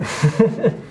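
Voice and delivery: quick amused laugh; a light laugh at himself; short laugh. Real, not acted. Laughing at slow sanding progress.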